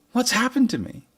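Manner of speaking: high, puzzled, questioning tone; worried listener voice. Retold or imitated. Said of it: "some of you were walking around thinking".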